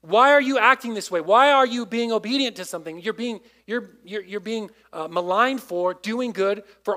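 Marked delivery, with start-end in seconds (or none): 0.0-3.0 louder, accusing questioning; acted hostile demand for an explanation; mock inquisition. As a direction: fire off the questions like an interrogator. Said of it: "like, an inquisition".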